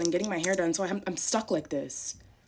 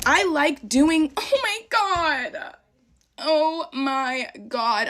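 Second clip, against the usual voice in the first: high, drawn-out exasperation; slow, rising cry of irritation; exasperated outburst; 8 semitones higher, 4 dB louder, much slower than usual. Real, not acted. Makeup lover fed up with older critics, exasperated.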